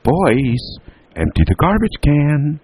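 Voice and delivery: high, drawn-out call. A narrator's reading. Mother interrupts her boys' games, calling out. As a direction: pitch it way up to carry through the house. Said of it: "their mother said".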